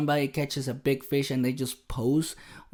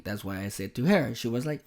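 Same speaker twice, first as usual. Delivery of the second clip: ordinary talk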